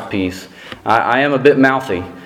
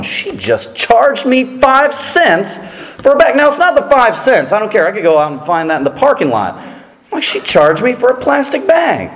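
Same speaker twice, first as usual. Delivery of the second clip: outraged disbelief; 12 semitones higher than usual. Retold or imitated. Indignant over bag fee.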